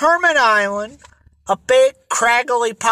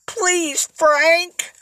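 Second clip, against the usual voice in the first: implore, pitched well above usual; tearful pleading; 8 semitones higher than usual. A narrator's reading. Lola begs Frank to let her join, tearfully pleading. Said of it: "Her eyes filled with tears".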